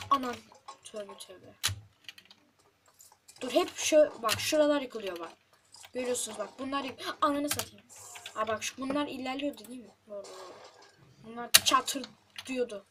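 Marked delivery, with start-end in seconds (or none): none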